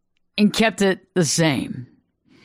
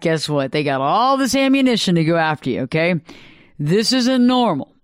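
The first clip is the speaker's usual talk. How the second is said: normal talking voice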